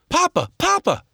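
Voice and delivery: put-on high young boy calling out; a frightened child crying out for papa; boy's voice. Young Joel runs to meet his father, high child voice.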